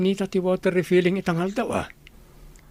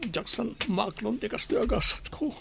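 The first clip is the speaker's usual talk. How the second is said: crying; 7 semitones higher, 6 dB quieter than usual. Acted. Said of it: "agsangit ka mo".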